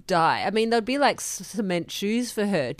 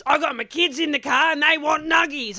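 angry robber; 8 semitones higher than usual. Acted. Robber shouting at the worker about nuggets for his kids. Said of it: "quick role play with me".

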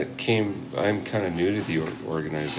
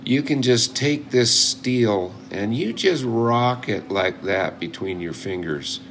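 put-on old coot's drawn-out American accent; slow, lifted mock-American instructor voice; 3 semitones higher than usual. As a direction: imitate an old American picker, drawing out the words. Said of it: "I'm doing your American accent".

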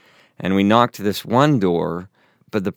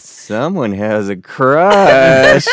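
slow, sing-song teasing, a bit louder; teasing companion; 4 semitones higher, 7 dB louder, much slower than usual. Retold or imitated. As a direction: drag it out to tease him. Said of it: "my mission companion goes".

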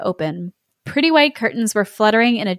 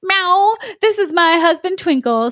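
higher, proud little cat wife; proudly; 8 semitones higher than usual. A narrator's reading. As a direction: show off her husband. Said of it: "said Snowflake proudly".

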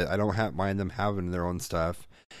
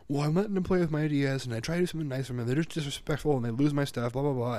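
higher-pitched, run-on aggrieved complaint; mock kid's rant; 6 semitones higher, faster than usual. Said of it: "do the whole rant thing".

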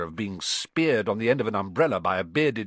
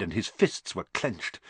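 ordinary delivery, nothing marked

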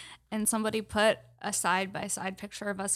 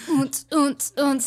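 mock club beat; 4 semitones higher, 5 dB louder, slower than usual.

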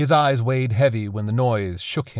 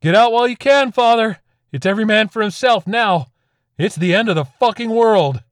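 frantic yell, much higher and louder; 12 semitones higher, 9 dB louder than usual. A narrator's reading. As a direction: scream it over your shoulder as you flee. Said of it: "yelling over his shoulder".

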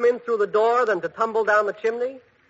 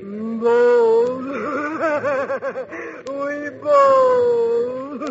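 ghostly voice; 5 semitones higher, much slower than usual. Acted. Ghost answers Bud in castle.

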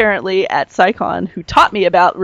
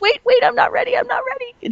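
very high, small pleading voice; half-joking plea to hold on; mock panic; 3 dB quieter than usual. User begs computer to delay forced update, mock-panicked.

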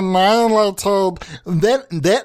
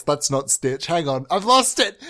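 ordinary delivery

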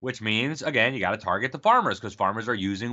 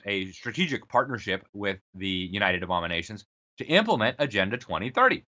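ordinary delivery

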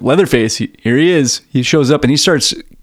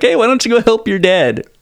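mom voice; 9 semitones higher than usual. Retold or imitated.

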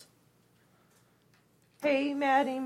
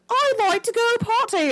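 high mock British accent; 13 semitones higher than usual. Roommate announcing a party, high British accent. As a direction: imitate her sudden British accent, pitched high. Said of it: "When the fuck did you become British?".

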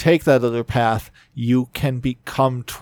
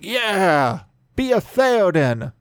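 mocking; 6 semitones higher, slower than usual. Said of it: "But it's like".

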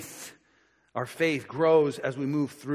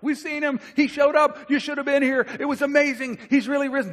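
high, eager gushing about the risen Lord; excited disciples; 11 semitones higher, 3 dB louder than usual. Retold or imitated. Disciples gushing to Thomas, high and excited. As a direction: pile it on with breathless excitement. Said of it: "the original language is very emphatic".

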